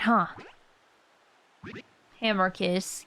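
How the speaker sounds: normal talking voice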